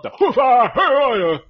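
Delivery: polite line breaking into a loud, high outburst; imitated tic outburst. Neighbor wants to buy the Cadillac, imitated tic outburst. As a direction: mimic words hijacked by a vocal tic. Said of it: "And he's like".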